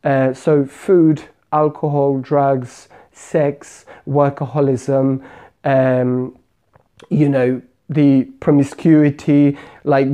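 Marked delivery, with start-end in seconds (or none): none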